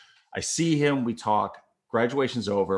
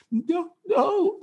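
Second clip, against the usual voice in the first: very high, strained plea; tight-throated. Retold or imitated. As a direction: squeeze it out through a tightening throat. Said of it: "your throat gets tight".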